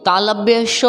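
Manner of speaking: श said as "sha", tongue to the palate; palatal श. Shown as a demonstration. Teacher introduces the letter, श as palatal "sh". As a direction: make the श of तालव्य श a clear "sh". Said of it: "तालु में".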